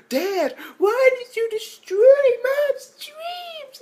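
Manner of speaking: very high, slow, hammed-up wail; mock crying daughter. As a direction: cry it out at dad in fake anguish. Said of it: "she's like".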